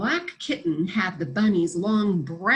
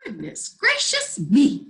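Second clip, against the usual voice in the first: exclaiming; 5 semitones higher, 4 dB louder than usual. Acted. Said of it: "exclaimed the cat".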